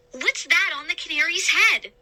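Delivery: high, pleading question; grasping at hope; desperate boy. A narrator's reading. Boy pointing into the cage, high desperate question.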